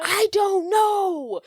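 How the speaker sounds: high, long-drawn exasperated cry. Real, not acted. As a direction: stretch it out high in disbelief. Baffled by the silly plot.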